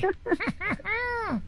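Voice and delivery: sudden squealing laugh; high burst of laughter. Real, not acted. (carcajada aguda)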